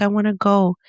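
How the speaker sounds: normal talking voice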